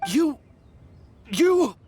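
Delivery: cornered bandit losing his temper; sputtering rage. Acted. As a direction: choke on your fury, then spit it out.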